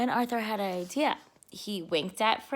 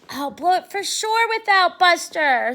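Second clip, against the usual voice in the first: worried fretting; 3 semitones higher, 6 dB louder than usual. Acted. Arthur fretting about the sneeze prank.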